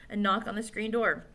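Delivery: ordinary delivery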